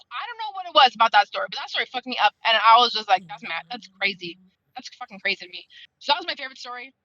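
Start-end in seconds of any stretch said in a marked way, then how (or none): 3.3-5.7 much softer and lower, stunned; quiet disbelief. Retold or imitated. Reader recalling a story that upset her, quietly stunned. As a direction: drop to a low murmur of disbelief.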